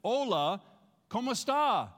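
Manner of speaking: Spanish greeting called out to the congregation; leading the greeting. Shown as a demonstration. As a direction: call it out for the crowd to repeat. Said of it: "Say that with me".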